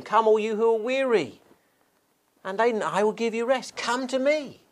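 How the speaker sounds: lightly voiced shepherd calling his flock in. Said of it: "That's the shepherd calling".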